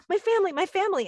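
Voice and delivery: put-on high, loud, wailing child's cry; upset child crying out. Small boy at the park calling to strangers, high tearful wail. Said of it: "He goes".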